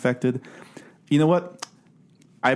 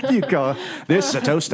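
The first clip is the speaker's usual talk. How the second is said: mock Australian; 3 semitones higher than usual. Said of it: "Crocodile Dundee".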